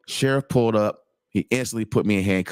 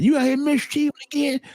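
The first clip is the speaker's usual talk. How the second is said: southern sheriff voice. Retold or imitated. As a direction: play the good-old-boy sheriff teasing. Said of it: "He said it just like that".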